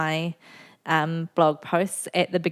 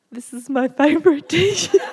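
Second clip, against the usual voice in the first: higher, louder, laughing through the quote; 9 semitones higher, 4 dB louder than usual. Retold or imitated. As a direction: quote him while cracking up. Husband voiced, laughing while quoting.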